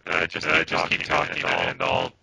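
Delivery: deep glitchy digitized voice; distorted robotic mic. Real, not acted. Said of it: "it's spreading from Pete".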